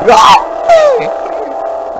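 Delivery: high, breathy shriek of fright; sudden terrified shriek; scream. Real, not acted. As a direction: shriek at the jump scare. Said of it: "I didn't scream".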